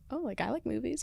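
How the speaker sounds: very soft, half-laughing answer; coy reply, retold. Young actor replying to producers about a movie, soft coy retelling. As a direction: answer softly, playing it casual.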